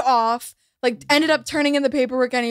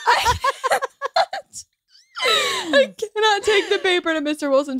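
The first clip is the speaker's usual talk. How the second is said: laughing while quoting; 8 semitones higher than usual. Retold or imitated. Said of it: "Daisy goes".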